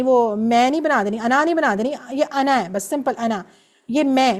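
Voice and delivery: usual speaking voice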